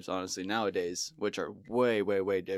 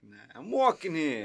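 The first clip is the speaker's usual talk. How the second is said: mock New York accent; 5 semitones higher than usual. Riffing New York accent bit.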